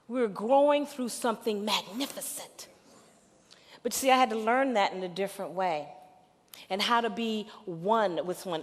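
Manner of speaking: ordinary talk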